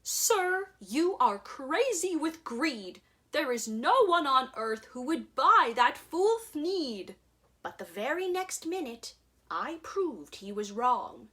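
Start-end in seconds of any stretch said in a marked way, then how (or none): 0.0-7.2 raised, scolding Lorax; lightly voiced Lorax, raised and higher. A narrator's reading. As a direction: scold him like a fussy little guardian. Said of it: "The Lorax said".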